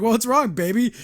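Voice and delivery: a hint of a sweet, clueless husband calling her baby; loving husband. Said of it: "He's like".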